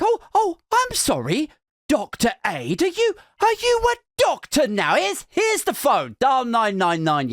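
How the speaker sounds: high-pitched mock apology turning scornful; sarcastic outrage. Girlfriend retorting to Nurofen suggestion, scathingly sarcastic. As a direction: swoop high with indignant sarcasm.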